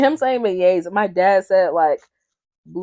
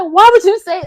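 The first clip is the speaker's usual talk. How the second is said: exasperated outburst; 11 semitones higher, 11 dB louder than usual. Retold or imitated. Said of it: "I said".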